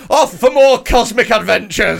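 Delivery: high, loud, drawn-out proclamation; mock adventurer's cry. Friend mocking the ex running off, hammed-up adventurer's cry. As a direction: announce it like a grand, silly departure.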